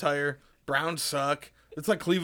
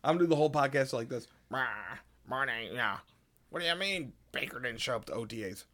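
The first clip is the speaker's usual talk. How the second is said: sports radio host voice; 2 semitones lower than usual. Acted. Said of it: "You just sound like that".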